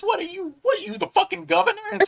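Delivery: Bill Burr. Retold or imitated. Comedian sneering at wildfire critics, high-pitched impression. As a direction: imitate a comedian's high, incredulous rant. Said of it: "he's like".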